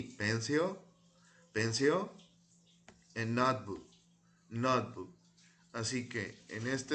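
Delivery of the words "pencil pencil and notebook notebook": "Pencil" and "notebook" each said twice, clearly, as a model; model pronunciation. Shown as a demonstration. Teacher drilling vocabulary, clear model words. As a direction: say each word crisply for the class to echo. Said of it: "Repeat after me".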